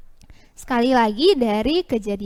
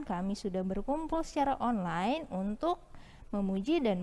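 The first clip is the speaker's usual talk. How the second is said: soft prayer voice; 9 dB quieter than usual. Acted. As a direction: pray quietly for the children. Leads children in prayer.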